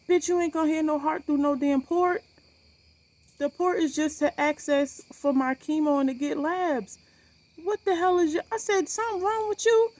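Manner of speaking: high-pitched, indignant telling-off; re-enacted exasperated confrontation; retold angry outburst. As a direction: tell off the nurse, voice climbing high.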